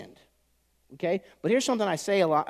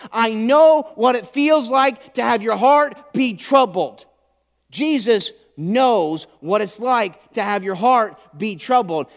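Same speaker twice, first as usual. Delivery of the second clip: loud, high and slow, leaning on each line; slow, loud preaching; 6 semitones higher, 11 dB louder, slower than usual. Acted. Pastor voices Jesus to the congregation, slow and loud. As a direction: drive every word home from the pulpit.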